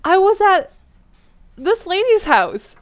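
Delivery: put-on little girl explaining to dad; innocent child answer. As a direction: answer brightly like a small kid. Child answering panicked father.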